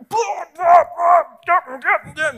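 high, loud imitated yelling; imitating a wrestler's ranting after a loss; mock ranting shout. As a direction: shout blah blah like a furious wrestler. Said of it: "he's verbally vomiting".

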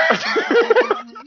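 squealing burst of laughter; high laugh. Real, not acted. Laughing at jogging scene.

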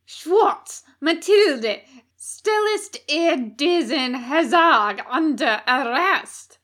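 put-on loud high German-accented commands; German-accented bark. A narrator's reading. Officer ordering guards to arrest Hastur, loud German-accented bark. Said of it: "A heavily accented voice barked from the light".

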